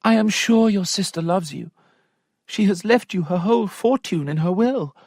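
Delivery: a daughter's plea, lightly voiced; young lady pleading. Sophia reasoning with her father, light high voice.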